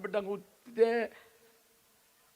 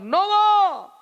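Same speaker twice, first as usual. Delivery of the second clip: much higher, louder desperate cry; calling out; 12 semitones higher, 12 dB louder, much slower than usual. Retold or imitated. People banging the ark door calling Noah, loud cry. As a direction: shout the name while banging the door. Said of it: "దబదబా అది అతున్నారు".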